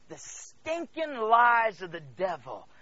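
slow, loud, drawn-out denunciation; emphatic disgust. Real, not acted. Preacher telling church to shut out the devil, scornful. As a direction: stretch it out with contempt.

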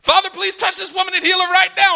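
high, urgent mock prayer; faithless showy prayer. Preacher praying over hurt woman, putting on fervour. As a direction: pray hard while doubting it works. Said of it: "while I'm praying, this faithless prayer".

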